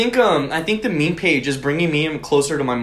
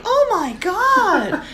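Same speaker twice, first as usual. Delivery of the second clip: high, drawn-out mock horror; imitated appalled mom; much slower than usual. Son retelling mom hearing more details, mock horror. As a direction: stretch it slowly in an appalled mom's high voice.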